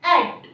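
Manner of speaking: एट said loud as one blend; loud phonics demo of एट; blended AT sound. Shown as a demonstration. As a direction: punch out एट as one short syllable. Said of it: "एटी का sound आएगा".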